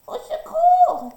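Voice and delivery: very high, loud, slow panicked cry; cry for help. A narrator's reading. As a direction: scream for help while fleeing. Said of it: "crie le petit lapin". (lapin poursuivi crie au secours, paniqué)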